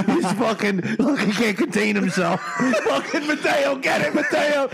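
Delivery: words breaking up with laughter; giggling. Retold or imitated. Friends in the corner cheering Mateo on, giggling. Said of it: "we're all, like, giggling".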